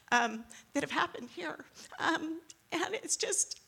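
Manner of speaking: holding back tears; teary. Real, not acted. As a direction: voice breaking with tears. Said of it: "I'm getting teary about that".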